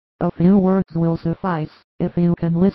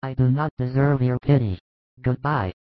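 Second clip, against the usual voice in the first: put-on defiant drunk apostle, lower voice; sullen Judas; 5 semitones lower than usual. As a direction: lower voiced young man pushing her off.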